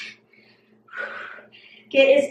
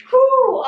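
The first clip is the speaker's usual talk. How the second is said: winded whew; 11 semitones higher, 5 dB louder than usual. Real, not acted. Trainer mid-lunges exhales.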